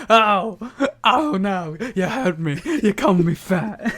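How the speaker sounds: mock hurt Dusty. Co-host mocks Dusty pretending to be hurt, laughing. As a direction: overplay Dusty's mock pain. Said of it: "And then Dusty's like".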